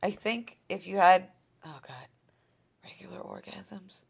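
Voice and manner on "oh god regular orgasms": whispered. Real, not acted. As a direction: whisper it, embarrassed. Embarrassed confession to friend.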